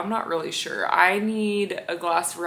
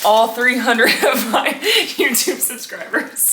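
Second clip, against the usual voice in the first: laughing; 6 semitones higher, 9 dB louder than usual. Real, not acted. Joking about tiny subscriber count.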